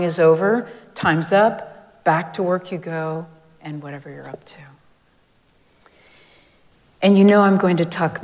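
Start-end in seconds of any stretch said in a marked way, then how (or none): 3.6-4.6 a soft unvoiced whisper; whispered aside. Real, not acted. Throwaway aside after timer list.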